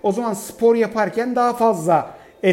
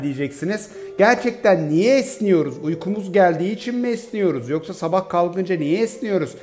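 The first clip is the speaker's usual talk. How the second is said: ordinary delivery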